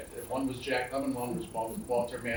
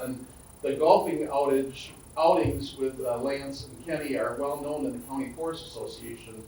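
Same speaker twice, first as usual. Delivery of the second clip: ordinary delivery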